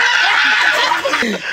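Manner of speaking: big breaking-up laugh. Real, not acted. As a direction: burst of laughter after chanting. Laughs after demonstrating the chant.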